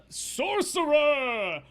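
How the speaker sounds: high, loud, drawn-out call; bartender yelling out. Acted. Bartender ordering drinks from the sorcerer, yelling. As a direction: holler the order across the bar. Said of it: "he, he yells out".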